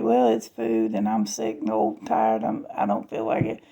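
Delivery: low, weary, worn-out complaint; tired grumble. Retold or imitated. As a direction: sigh it out like you're sick and tired. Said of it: "I'm like".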